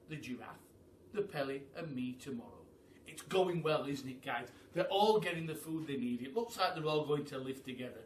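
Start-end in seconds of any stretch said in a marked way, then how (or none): none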